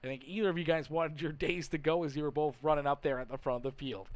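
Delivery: normal talking voice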